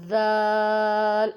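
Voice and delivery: ذال drawn out long and loud with tongue tip on the teeth; slow careful ذال; articulated dhal. Shown as a demonstration. Teacher showing where ذال is made. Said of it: "دونوں سرے آپس میں لگیں".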